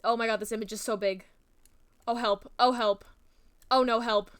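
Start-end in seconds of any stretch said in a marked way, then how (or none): none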